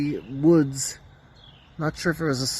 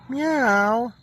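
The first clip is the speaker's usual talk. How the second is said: mock meow; 7 semitones higher than usual. Meowing at the cat.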